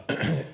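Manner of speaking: short throat clear. Real, not acted. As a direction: clear the throat, then apologize. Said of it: "excuse me".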